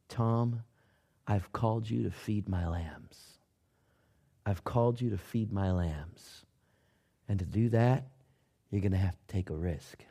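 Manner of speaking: low, soft, gentle calling voice; soft inner voice. Retold or imitated. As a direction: quietly voice the divine call. God's voice in his mind.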